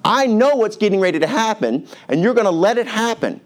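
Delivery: exasperated, high-pitched outburst as Moses; acted frustration, pitched high; frustrated. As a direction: vent at a stubborn man, voice pushed way up. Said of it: "He's frustrated with this man".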